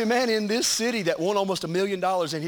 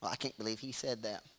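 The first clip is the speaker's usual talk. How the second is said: prim churchgoer; 10 semitones lower, 16 dB quieter than usual. Retold or imitated. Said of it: "too religious for me to talk like this".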